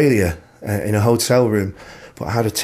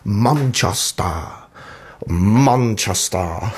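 slow, drawn-out mock northern English "Manchester"; bad northern English accent; much slower than usual. Van driver echoing the host's home town, bad northern English accent. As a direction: imitate a botched northern English accent, stretching every vowel. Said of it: "trying to do an English accent".

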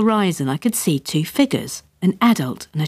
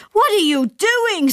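high, drawn-out incredulous yell; outraged high-pitched demand; screamed in outrage; slower than usual. A narrator's reading. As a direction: shriek it in disbelief without full volume. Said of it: "screamed my father".

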